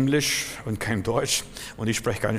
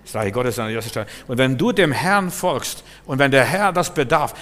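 ordinary delivery

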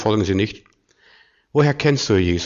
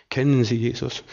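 soft, even question; understated, softly spoken; calm, undramatic question; 5 dB quieter than usual. Retold or imitated. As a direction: ask it quietly and without any excitement. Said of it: "gar nicht so dramatisch".

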